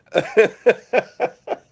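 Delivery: loud, high burst of real laughter; high cackling laugh. Real, not acted. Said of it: "speaking of laughing out loud".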